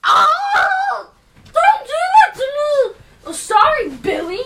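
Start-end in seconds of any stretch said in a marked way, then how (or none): 0.0-3.0 high startled yell; startled shout. Acted. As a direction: cry out after being hit. Reacting to a slap.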